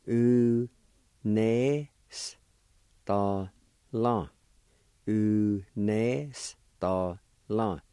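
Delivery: very slow, each syllable of "U nes ta la" pulled apart; teacher spelling out "U nes ta la" slowly, syllable by syllable; slow syllable demo. Shown as a demonstration. Teacher to students learning Cherokee, slow syllable-by-syllable demo. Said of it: "It's pronounced like this".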